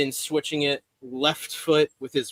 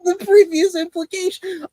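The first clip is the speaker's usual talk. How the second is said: laughing disbelief; 6 dB louder than usual. Real, not acted. Incredulous repeat while laughing.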